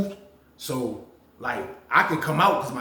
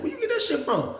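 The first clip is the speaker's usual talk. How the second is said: surprised question. Retold or imitated. Asking roommate about the weed.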